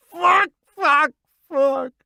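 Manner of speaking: raging repeated shouts; yelling at top of lungs. A narrator's reading. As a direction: bellow each word in fury. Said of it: "I cry at the top of my lungs".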